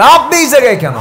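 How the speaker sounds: high, loud acted astonishment; retold startled question; startled judge. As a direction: voice a judge jumping up in shock. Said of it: "বিচারপতি লাফ মেরে দাঁড়ায় গেছে".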